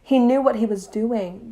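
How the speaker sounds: usual speaking voice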